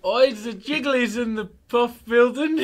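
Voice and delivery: high, loud, put-on Australian-British accent; mock Australian-British accent. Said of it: "say something Australian in a British accent".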